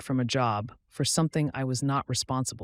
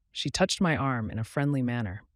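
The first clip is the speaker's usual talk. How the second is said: plain reading voice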